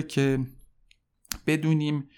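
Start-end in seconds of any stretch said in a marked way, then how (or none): none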